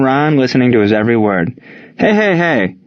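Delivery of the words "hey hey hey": raised, higher-pitched shout to break in; restrained shout for attention. A narrator's reading. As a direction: cut through the chatter. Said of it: "Buck shouted out".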